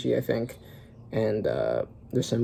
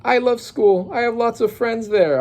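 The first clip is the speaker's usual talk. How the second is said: put-on young girl voice; 9 semitones higher than usual. Child Ronya speaks in manga.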